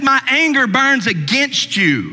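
slow, high-pitched pronouncement of wrath; stern divine anger. Acted. Preacher quotes God rebuking Job's friends, slow stern anger. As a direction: voice God's anger, drawing out the words. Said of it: "He just said".

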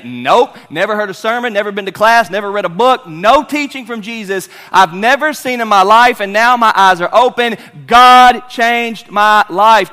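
loud, high, emphatic testimony; Bartimaeus testifying. Acted. Healed blind man testifying to his interviewer, emphatic. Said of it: "Bartimaeus gonna say".

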